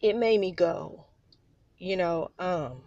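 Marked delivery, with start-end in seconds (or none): none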